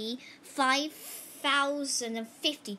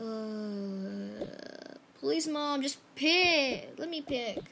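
ordinary talk

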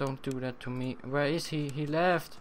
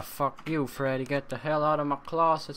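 usual speaking voice

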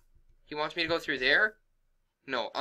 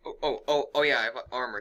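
normal talking voice

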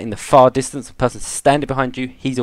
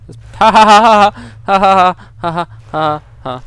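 high, loud cackling ha ha ha; mock laugh; 7 semitones higher, 6 dB louder than usual. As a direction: cackle it out like a villain. Mocking laugh voiced by game.

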